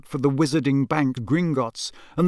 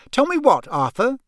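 much higher, sharp demanding wife; suspicious wife; 10 semitones higher, 3 dB louder than usual. Acted. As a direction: pin her husband down.

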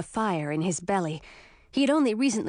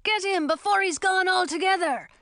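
higher, urgent yell; 9 semitones higher than usual. A narrator's reading. Townsman urges the mob to catch the dragon, yelling. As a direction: urge the crowd to attack. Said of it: "yelled another voice".

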